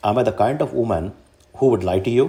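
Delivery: ordinary delivery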